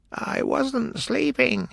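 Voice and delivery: high, slow, sleepy hoarse voice; hoarse Dormouse. A narrator's reading. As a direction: drag it out like a drowsy dormouse. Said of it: "he said in a hoarse voice".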